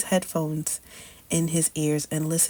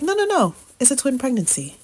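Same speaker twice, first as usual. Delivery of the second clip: chirpy sonographer; 8 semitones higher, 4 dB louder, faster than usual. Retold or imitated. Sonographer insisting on twins.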